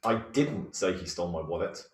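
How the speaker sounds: didn't hit hard, rest of the line easy; stress on didn't. Shown as a demonstration. Tim demonstrates the second word, stress on didn't. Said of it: "Tim, if you do the second".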